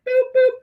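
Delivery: high sing-song boop sounds; mimicking the airbrush pulse; playful boops. Shown as a demonstration.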